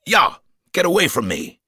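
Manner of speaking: loud, high panicked shout; yell. A narrator's reading. Hector yelling at the crocodile, loud panicked shout. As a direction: yell him off in terror. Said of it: "he yelled".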